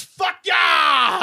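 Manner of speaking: high, drawn-out triumphant holler; yelling. Acted. Drunk man swinging a giant salami in a bar, yelling.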